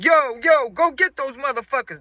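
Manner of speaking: high, loud rallying cry; imitated alarm shout. Party dudes setting off the chase, imitated high loud shout. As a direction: holler to send everyone after them. Dudes send crowd after thieves.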